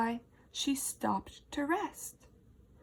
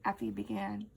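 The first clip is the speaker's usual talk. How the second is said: ordinary delivery, nothing marked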